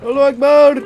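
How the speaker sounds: loud hammed-up battle cry; mock war cry. Gamer yelling a joke war cry while charging.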